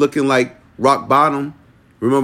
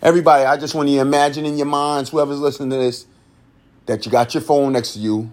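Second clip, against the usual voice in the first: ordinary talk, nothing marked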